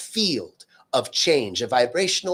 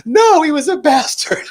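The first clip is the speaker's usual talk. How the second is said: much higher and louder mock-gleeful cry; gleeful outburst; 14 semitones higher, 11 dB louder than usual. Friend reveals she's glad her ex died, gleeful outburst. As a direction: blurt it out, delighted, not sad at all. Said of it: "She goes".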